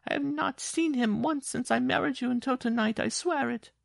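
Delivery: high-pitched, desperate tearful oath; sobbing. A narrator's reading. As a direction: swear it in a high wail. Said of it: "she sobbed".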